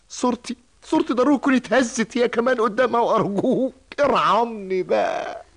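high, distraught plea slowing at the end; tearful pleading. Acted. Employee ashamed before his daughter begs for mercy, tearful. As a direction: beg for mercy through tears.

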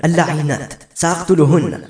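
contained narrated outburst of rage; roaring anger. A narrator's reading. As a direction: raise the pitch in fury at enemies. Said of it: "وصرخ بغضب هادر".

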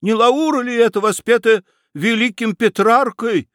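very high, excited question; exclaiming. A narrator's reading. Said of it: "воскликнул Федяшев". (восторженно, высоким голосом)

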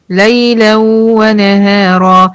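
slow recitation holding the final alif; teacher recites the verse to show the madd; Quran recitation. Shown as a demonstration. تلاوة بطيئة مع مد الألف. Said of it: "طب ما هي ليلا برضو فيها مد عوض".

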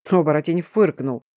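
plain reading voice

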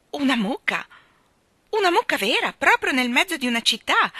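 high, loud incredulous questions; amazed disbelief. A narrator's reading. Jane hearing of a cow in town, amazed disbelief. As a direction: squeal it in astonishment.

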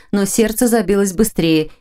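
plain reading voice

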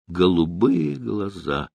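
usual speaking voice

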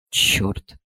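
whispered under the breath; muttered curse. Acted. Fed-up parent reading the school chat, whispered curse. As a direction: hiss the curse to yourself, unvoiced. Mother reacts to chat message.

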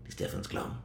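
menacing whisper. Acted. Detective boasting of perfect murder.

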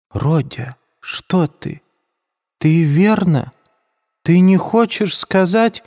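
high, slow frightened questions; frightened mother. A narrator's reading. Пульхерия Александровна в испуге спрашивает сына, высоким испуганным голосом. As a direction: stammer out fearful questions to her son. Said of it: "начала было в испуге".

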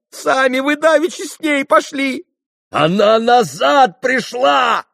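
high, loud argument; heated shouting. A narrator's reading. Fenya and Mitya arguing over her whereabouts, heated shouting. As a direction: fling it back at full voice.

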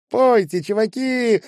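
put-on high, loud girl's shout; high-pitched yell to the band; calling out. A narrator's reading. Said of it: "выкрикивала высокая".

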